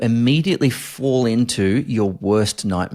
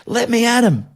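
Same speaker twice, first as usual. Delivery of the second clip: frantic cry; 12 semitones higher than usual. Retold or imitated. Shouting at his wife, sure intruders are in the house, frantic. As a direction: cry out, panicked, hunting for intruders. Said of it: "I go".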